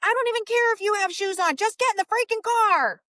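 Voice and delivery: high, loud, fast mock scream at the kids; screaming mom. Mom screaming at kids who are late for the car, a hammed-up yell. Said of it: "she's screaming".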